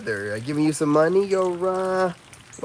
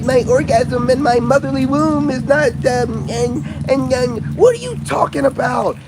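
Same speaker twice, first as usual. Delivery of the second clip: mocking women; 8 semitones higher than usual. Mocking women's online talk.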